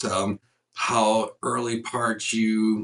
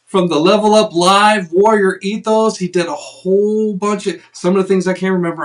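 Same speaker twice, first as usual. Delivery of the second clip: loud, high announcer welcome; big announcer voice welcoming viewers; show host intro; 8 semitones higher, 7 dB louder than usual. Acted. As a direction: open the show like a host hyping the crowd. Introducing guest show-style.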